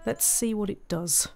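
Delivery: ordinary talk, nothing marked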